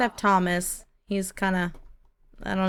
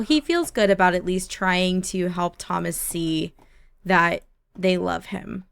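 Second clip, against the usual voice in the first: ordinary talk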